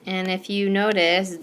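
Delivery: usual speaking voice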